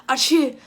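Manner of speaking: loud fake sneeze. Acted sneeze.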